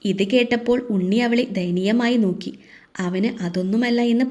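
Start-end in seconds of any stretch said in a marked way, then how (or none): none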